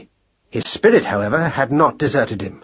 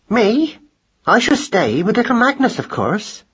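protective mother; 10 semitones higher than usual. A narrator's reading. Mother firmly stays with baby.

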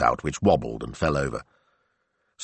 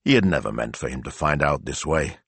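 plain reading voice

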